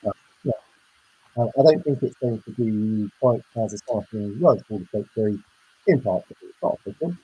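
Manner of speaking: muffled, garbled connection; underwater voice. Real, not acted. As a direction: sounds like talking underwater. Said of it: "You sound like you're underwater".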